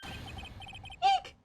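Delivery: a sudden high squeal of fright; startled eek. Acted. Startled girl shrieking at a surprise. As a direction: shriek in shock.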